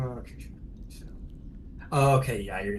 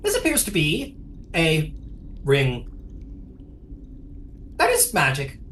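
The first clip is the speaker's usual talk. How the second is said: a hint of Kenku chirp; lightly voiced Kenku; 4 semitones higher than usual. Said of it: "Scribble will refer to the ring ... and goes".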